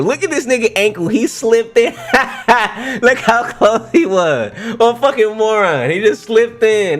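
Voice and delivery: high, laughing ridicule; laughing mockery. Real, not acted. Viewer roasting a man who slipped in, laughing mockery. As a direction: crack up while mocking him.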